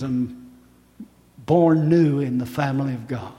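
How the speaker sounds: low, quiet and gentle; tender, soft appeal. Real, not acted. Preacher softly appealing to listeners to receive Jesus. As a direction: speak softly of His love.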